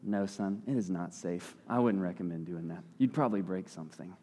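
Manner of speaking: put-on low, soft, calm reassurance to a child; hushed and unhurried, like a patient parent. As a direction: gently talk your son down, quietly. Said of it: "you can just calmly say".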